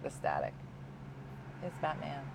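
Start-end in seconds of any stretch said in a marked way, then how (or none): 1.6-2.2 curt dismissive answer. Retold or imitated. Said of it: "that's how she talked to us".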